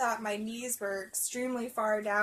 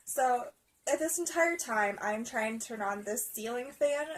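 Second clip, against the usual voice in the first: ordinary talk, nothing marked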